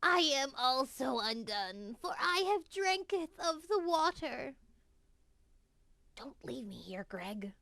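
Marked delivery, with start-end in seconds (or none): 6.2-7.5 weak dying whisper. Acted. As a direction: breathe the plea as you die. Dying brother pleads.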